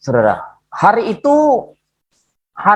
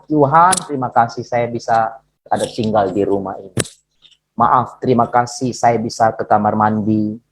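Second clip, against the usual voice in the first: lowered voice of simple gratitude; humble prayer; 6 semitones lower than usual. Retold or imitated. Preacher thanking God for home and bathroom, humbly. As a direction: pray thanks in a low register.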